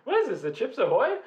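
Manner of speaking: high-pitched, incredulous retold question; mock bewilderment. Storyteller replaying finding a cookie mid-kiss, mock bewilderment.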